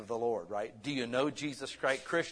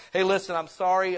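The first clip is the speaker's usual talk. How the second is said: ordinary talk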